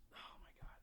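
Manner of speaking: barely voiced, shocked; a hushed oh my God; awed whisper. Real, not acted. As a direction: breathe it out in a stunned whisper. Stunned reaction to the woman.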